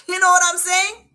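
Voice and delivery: lively, amused push; excited aside. Real, not acted.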